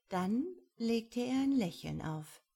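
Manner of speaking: usual speaking voice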